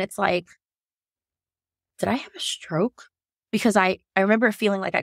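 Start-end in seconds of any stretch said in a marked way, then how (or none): 2.0-3.1 whisper. Retold or imitated. Panicked inner thought at hospital.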